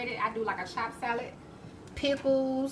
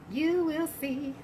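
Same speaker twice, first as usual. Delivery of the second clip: drawn-out sassy; 6 semitones higher, slower than usual. Pretend. Slow drawn-out promise.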